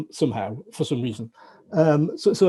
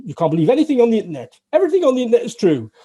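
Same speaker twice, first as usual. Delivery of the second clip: fast, high and louder joke patter; quick, higher mock-serious pronouncements; comic punchline delivery; 8 semitones higher, 5 dB louder, faster than usual. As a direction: rattle off the contradiction for laughs. Reciting contradictory joke lessons.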